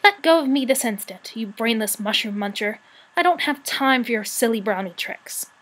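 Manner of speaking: usual speaking voice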